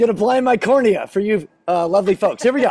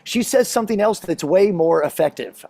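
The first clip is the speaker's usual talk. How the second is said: ordinary talk, nothing marked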